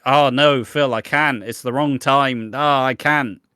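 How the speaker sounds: loud, melodramatic refusal; mock anguish. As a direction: wail it like a soap heroine. Acts Sharon's anguished refusal.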